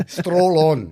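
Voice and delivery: a touch of Glaswegian, light and amused; Scottish accent. Retold or imitated. Retelling the Glasgow friend's catchphrase, mock Scottish accent. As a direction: echo the hard-man Scottish line with a grin. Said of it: "Scottish, my God".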